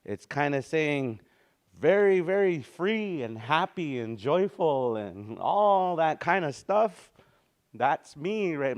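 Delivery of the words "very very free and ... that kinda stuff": joyful and bright. Shown as a demonstration. Describing joyful meaning.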